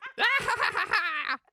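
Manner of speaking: high, loud ghoulish cackle; Crypt Keeper cackle. Acted. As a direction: cackle like a gleeful corpse. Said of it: "that's my Crypt Keeper".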